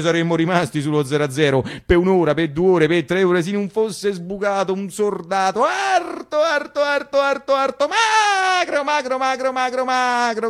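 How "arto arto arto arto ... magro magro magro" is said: high, climbing emphasis on each repeat; marvelling at the tall thin soldier; exaggerated emphasis. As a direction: stretch every repeat higher and higher.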